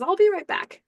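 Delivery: high-pitched aside; Warren's parting line. Acted. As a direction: toss it off as a cheerful exit. Warren voiced leaving the group.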